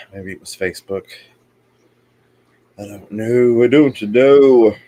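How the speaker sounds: teary after a coughing fit; strained, teary voice, slowing down; after crying. Real, not acted. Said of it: "Now I'm all teary-eyed".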